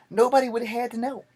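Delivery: high, loud angry yell; angry shout. Retold or imitated. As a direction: shout it down at him. Angry at his attacker.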